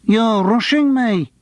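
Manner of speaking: slow, high dopey Lancashire drawl; slow gormless Lancashire catchphrase, pitched high; gormless Lancastrian accent. Acted. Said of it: "Again, the accent".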